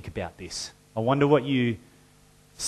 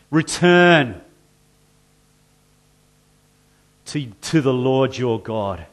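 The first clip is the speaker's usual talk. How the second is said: loud call to return; 6 dB louder than usual. Acted. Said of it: "place your name in that little gap".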